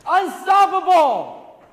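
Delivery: high, slow triumphant shout; shouted rally cry. Real, not acted. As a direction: roar it out to the crowd. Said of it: "Repeat after me".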